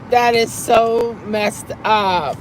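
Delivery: drawn-out mock outrage; laughing disbelief, slowed down; slow amused emphasis. Real, not acted. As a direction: stretch it out, teasing. Laughing reaction to chat.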